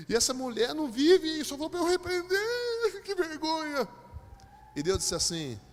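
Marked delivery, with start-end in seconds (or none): 0.1-3.9 high, drawn-out hammed-up whine; mock wailing complaint. Humiliated preacher complains to God, mock wailing. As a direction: climb into an embarrassed, comic wail.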